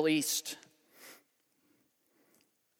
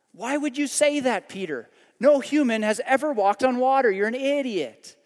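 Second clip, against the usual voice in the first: mock scolding; 6 semitones higher, 6 dB louder than usual. Mock rebuke of Peter.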